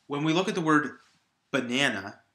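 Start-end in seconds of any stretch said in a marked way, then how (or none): 1.5-2.2 "banana" with the middle syllable stressed. Shown as a demonstration. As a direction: say "banana" the standard way, leaning on NAN. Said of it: "putting the stress on the middle syllable".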